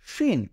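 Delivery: শিন said high, the sh sound clear; letter name shin, high. Shown as a demonstration. Teacher announcing the letter শিন to students, high. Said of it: "এটা হলো".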